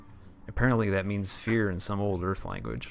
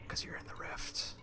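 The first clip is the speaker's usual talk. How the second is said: whisper; 14 dB quieter than usual. Acted. Reading eerie log entry.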